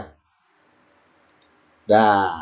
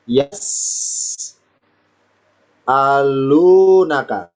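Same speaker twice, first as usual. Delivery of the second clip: "Yas'alunaka" stretched very slowly, lower; very drawn-out reading; 5 semitones lower, much slower than usual. Shown as a demonstration. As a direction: draw out every sound of "Yas'alunaka". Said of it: "Misalnya loh ya". ("Yas'alunaka" dipanjangkan sangat pelan)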